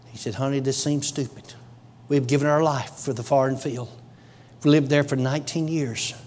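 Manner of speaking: low, soft narration with long pauses; hushed testimony storytelling. Real, not acted. Preacher retelling couple's conversation in the car, hushed and low. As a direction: draw listeners in quietly, voice dropped.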